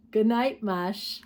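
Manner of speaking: ordinary delivery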